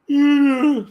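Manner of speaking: sudden burst of high laughter; loud high laugh. Real, not acted. Laughs at own remark.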